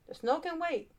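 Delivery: teacher calling the class to order. A narrator's reading. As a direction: address the class as their teacher. Teacher voice in story.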